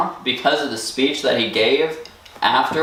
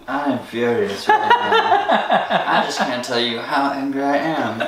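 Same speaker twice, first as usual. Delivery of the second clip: seething anger. Retold or imitated. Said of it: "let's all do our Ben Carson".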